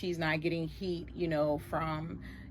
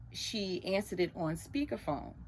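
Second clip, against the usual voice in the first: usual speaking voice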